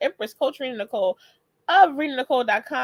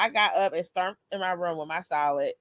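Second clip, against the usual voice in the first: ordinary talk, nothing marked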